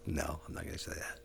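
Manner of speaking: hushed whisper. Real, not acted. Whispering scared in a thin-walled hotel room. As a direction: barely breathe it out.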